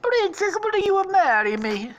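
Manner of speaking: high, loud cartoon suitor; Mario. Suitor proposing on one knee, loud high voice. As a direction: propose in an excited falsetto. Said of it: "gets down on one knee".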